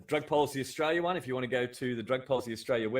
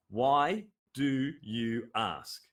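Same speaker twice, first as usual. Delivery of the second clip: "Why do you ask?" drawn out very slowly, each word calm and even; slow demo of the phrase; much slower than usual. Shown as a demonstration. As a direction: say it slowly and politely, no edge. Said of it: "seems like a very simple phrase".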